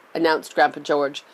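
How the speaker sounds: usual speaking voice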